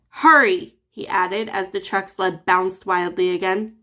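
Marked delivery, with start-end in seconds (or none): 0.1-0.7 loud, urgent hurry; urgent shout. Acted. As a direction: bark it out urgently. Urgent command as truck bounces.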